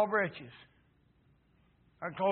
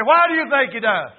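high, loud challenge; raised-voice imagined questioner; insistent question; 8 semitones higher, 14 dB louder than usual. Acted.

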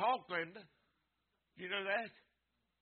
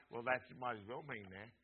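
ordinary talk

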